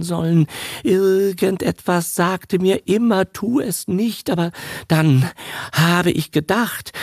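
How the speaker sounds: put-on a tired old man rambling his regrets; lightly voiced old man. A narrator's reading. A touch of a guilty old father lamenting to his son.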